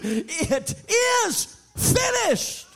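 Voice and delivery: high, slow shout of the last word; shouted climax. Acted. Preacher crying out to the congregation, high slow shout. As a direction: hold and cry it out.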